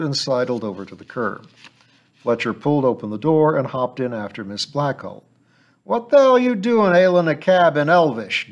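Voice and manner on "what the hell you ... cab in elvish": higher, louder indignant driver demand. Acted. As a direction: challenge the odd fares. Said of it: "demanded the driver".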